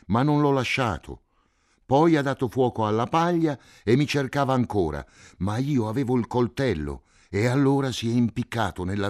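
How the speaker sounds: high, loud and panicked; a terrified boy crying out; yelling in fear; frightened shouting. A narrator's reading. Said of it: "gridò Cinto".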